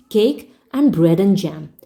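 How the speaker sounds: usual speaking voice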